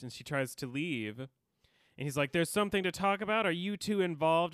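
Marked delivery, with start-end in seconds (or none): 2.3-4.5 raised, higher voice imitating an angry confrontation; mounting anger, retold. Podcaster retells Oz confronting Tara, mounting anger. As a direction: press the accusation fast and louder, mock-angry. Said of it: "he's getting angrier and angrier".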